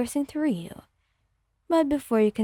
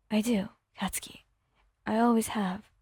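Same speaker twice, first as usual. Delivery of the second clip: whispered, lower and tender; 3 semitones lower, 5 dB quieter than usual. Acted. Wyon answering Katsuki's promise, whispered tenderly. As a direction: breathe the words close to him.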